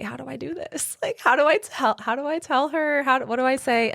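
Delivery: high, fretful self-talk; panicked inner voice. Retold or imitated. To herself, unsure how to tell the client, panicking high. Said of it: "I'm like".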